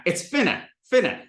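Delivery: "finna" with a plain N in the middle. Shown as a demonstration. Teacher giving the Southern form, no D. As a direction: say "finna" with no D at all. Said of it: "straight F I N N A".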